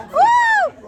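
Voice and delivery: loud high whoop; cheering whoop. Real, not acted. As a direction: whoop with delight. Cheering the play.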